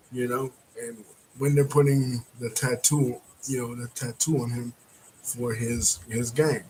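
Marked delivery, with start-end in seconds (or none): none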